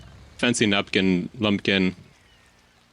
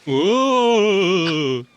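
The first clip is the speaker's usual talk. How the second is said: ghost voice; 7 semitones higher than usual. Pretend. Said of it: "I see you've been working on your ghost impression".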